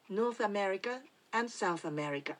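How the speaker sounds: North America and South America with English sounds and rhythm; English pronunciation. Shown as a demonstration. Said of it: "英語では". (英語の発音で)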